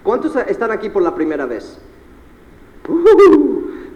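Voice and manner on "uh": high, loud "uh" whoop; high cheering hoot; crowd-rousing whoop. Real, not acted. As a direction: whoop it out for the newcomers. Cheering the first-timers.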